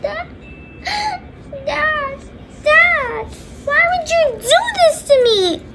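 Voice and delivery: very high, wailing sobbed plea; hammed-up tearful tantrum; crying. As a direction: bawl at a parent in fake tears. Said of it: "Why do I hear crying?".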